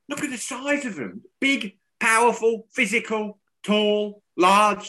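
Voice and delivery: high, excited pundit voice listing adjectives; imitating commentator Gage. Mocking the pundit's adjective list, high and animated. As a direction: ham up the gushing commentator. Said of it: "I won't do his accent".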